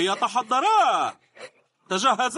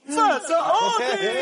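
surprised announcement; 8 semitones higher, slower than usual. Acted. Announcer shocked at upset win.

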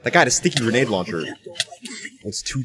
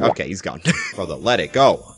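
ordinary delivery

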